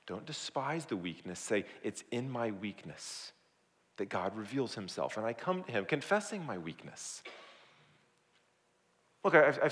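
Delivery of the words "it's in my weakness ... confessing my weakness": soft, subdued admission; humble confession. Acted. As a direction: confess it quietly. Modelling a quiet confession of weakness.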